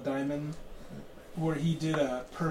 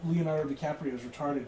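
ordinary talk

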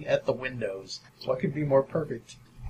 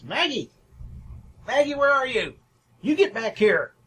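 high, loud shout into the dark woods; calling out; 10 semitones higher, 5 dB louder than usual. Acted. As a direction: yell for the runaway dog at the top of her voice. Woman calls for lost dog.

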